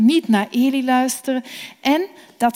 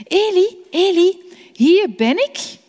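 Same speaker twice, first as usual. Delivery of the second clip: put-on young Samuel calling; 8 semitones higher, 4 dB louder than usual. Samuel wakes Eli urgently.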